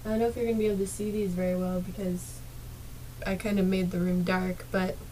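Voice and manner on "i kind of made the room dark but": usual speaking voice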